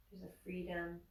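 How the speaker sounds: soft, unhurried meditation voice; guided meditation. Acted. Yoga teacher guiding students through Shavasana, soft meditation voice. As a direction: ease them gently into rest.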